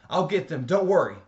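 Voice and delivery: a man calling out as he runs to the fire; loud. A narrator's reading. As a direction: shout it while already running. Man rushes to rescue, calling.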